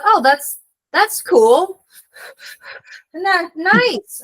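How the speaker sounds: high, loud, halting politeness; feigned approval. Retold or imitated. Traveler humoring a biker gang, nervously approving. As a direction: play along in a strained high voice.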